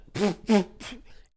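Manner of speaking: loud burst of mock laughter, acting out the dad; imitated laugh. Vlogger imitating the dad laughing on camera, loud mock laugh. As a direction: bark out a hammed-up laugh. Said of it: "And then the father does this".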